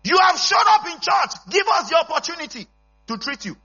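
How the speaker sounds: high, loud shouted exhortation; full-force preaching. Real, not acted. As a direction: shout it out to the congregation.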